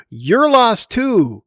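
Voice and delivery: high, slow accusing line; mock retort. Imagined other self answers back, slow and high. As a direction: drag it out, pointing back at himself.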